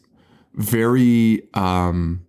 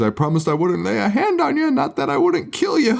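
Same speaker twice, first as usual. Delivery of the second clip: much higher, sly leprechaun taunt; 9 semitones higher than usual. Retold or imitated. Leprechaun taunting the girl, high sly mocking voice. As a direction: twist the promise with a high smug sneer. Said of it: "he says".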